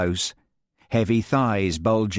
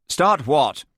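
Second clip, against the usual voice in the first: a sharp, imperious snap upward; imperious demand; 6 semitones higher, 4 dB louder than usual. Acted. Lady Jane imperiously challenging the nervous instructor. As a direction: demand it like a lady used to obedience. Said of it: "demanded a heavy-set woman imperiously".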